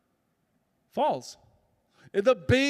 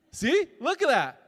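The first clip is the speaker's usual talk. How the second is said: put-on delighted baby babble; 2 semitones lower than usual.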